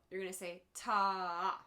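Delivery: ta stretched long for a half note; held ta. Shown as a demonstration. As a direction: hold the ta for two beats. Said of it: "Every time you see a half note".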